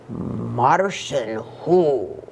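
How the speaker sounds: very slow, low, drawn-out question; suspicious questioning. Acted. Scapito questioning the voice in the closet, slow and low. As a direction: stretch each word out low and wary.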